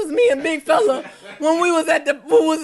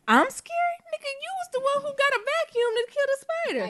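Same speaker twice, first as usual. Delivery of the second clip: high-pitched, fast comeback; indignant retort; 12 semitones higher, 5 dB quieter, faster than usual. Real, not acted. To partner who got a vacuum for the spider, indignant high retort. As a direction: throw the accusation back, voice way up high. Retorting to being called scared.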